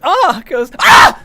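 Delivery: real frustrated scream; sudden scream. Real, not acted. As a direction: quote that breaks into a real high, loud scream. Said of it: "I'm sorry for freaking screaming".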